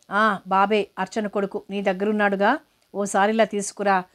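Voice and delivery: usual speaking voice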